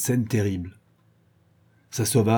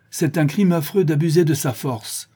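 higher, solemn rebuke; solemn interruption; 7 semitones higher, 4 dB louder than usual. Acted. Rodolphe interrupting the brigand, solemn. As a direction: cut in solemnly. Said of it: "d'une voix solennelle".

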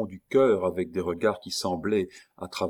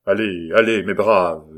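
loud, hollow voice from behind a mask; sepulchral voice; 9 dB louder than usual. Acted. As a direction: urge the henchmen on from the grave. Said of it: "dit une voix sépulcrale". (voix sépulcrale qui pousse les hommes)

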